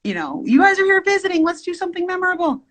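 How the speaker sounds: very high, rapid mock cajoling; squeaky-high, hurried "come on" act; imitating own eager pleading. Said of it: "I was the one that was like".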